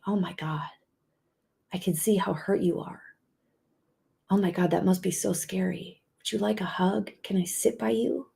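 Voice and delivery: tender comfort. Pretend. Comforting an angry, hurt partner.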